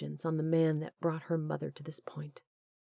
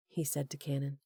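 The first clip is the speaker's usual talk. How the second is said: ordinary delivery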